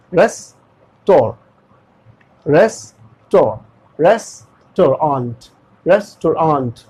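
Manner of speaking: रेस्टोरेंट slowly in parts, "res... to... raant"; broken and stretched. Shown as a demonstration. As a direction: split रेस्टोरेंट and lengthen "raant". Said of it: "ताकि वो stretch हो सके". (रेस्टोरेंट धीरे, तोड़कर और खींचकर)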